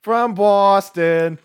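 put-on high, drawn-out Boston accent; hammed-up Boston fan accent; mock Boston accent. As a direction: stretch it out in a playful Boston accent. Joking Boston fan tagline.

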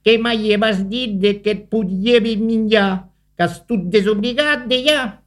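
a mildly cross spider scolding; spider's grumbling. A narrator's reading. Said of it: "a ruganiera aragna da despiete culera".

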